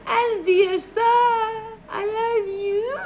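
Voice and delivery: very high, slow squealed I love you; high gushing love. Real, not acted. Streamer gushing high at a viewer. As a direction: gush over the viewer, stretching it out.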